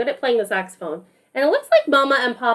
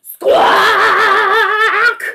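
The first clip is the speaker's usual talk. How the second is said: loud, high squawking honk; saxophone squawk; 8 semitones higher, 7 dB louder than usual. Acted. Miles's saxophone squawks loudly in the story, high honk. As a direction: blast out a squawky horn noise.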